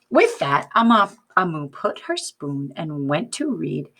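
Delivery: plain reading voice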